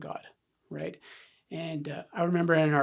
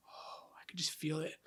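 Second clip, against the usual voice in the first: whispered aside to oneself; retold private dread, very soft; hushed inner dread; 15 dB quieter than usual. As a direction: breathe it out, barely voiced. Retold inner dread before crying.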